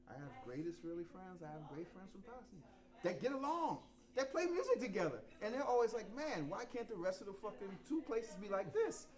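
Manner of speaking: normal talking voice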